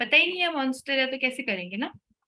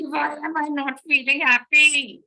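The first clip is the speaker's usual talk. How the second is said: louder, exasperated whine; mock frustrated complaint; 4 semitones higher, 6 dB louder than usual. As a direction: complain your affirmations fail, fed up. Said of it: "you go even more angry".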